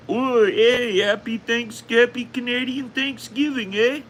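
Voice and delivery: high-pitched caricature of a cheery Canadian; hammed-up Canadian greeting, way up high; mock Canadian accent. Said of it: "some Canuck has been like".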